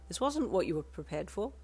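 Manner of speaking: ordinary delivery, nothing marked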